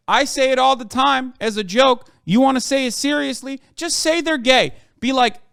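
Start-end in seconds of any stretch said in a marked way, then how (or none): 3.8-4.7 streamer scolding Twitter critics, pushing harder; raised exasperated repeat. Shown as a demonstration.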